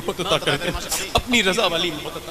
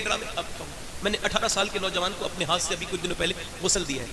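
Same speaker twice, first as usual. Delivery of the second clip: ordinary talk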